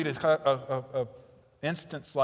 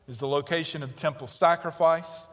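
normal talking voice

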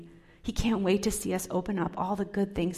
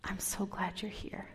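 tender whisper; 9 dB quieter than usual. Acted. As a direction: reassure someone gently. God's welcome to the doubting.

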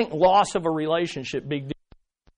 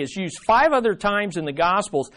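usual speaking voice

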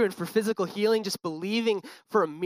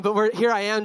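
usual speaking voice

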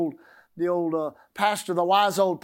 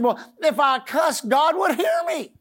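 high, louder protest, slowing on the last words; indignant grandma; 7 semitones higher, 3 dB louder, slower than usual. Retold or imitated. Grandma explains to the pastor, high and insistent. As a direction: insist it like a scandalized church lady. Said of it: "she said".

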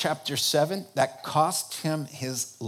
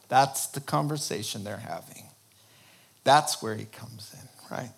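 normal talking voice

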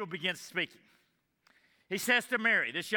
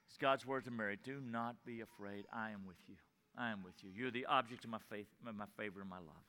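much softer, lower and hushed reassurance; tender comfort; 9 semitones lower, 12 dB quieter, faster than usual. Acted. God comforting the frightened listener, soft and tender. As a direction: murmur it gently as a comforting God. Preacher softly voices God's comfort.

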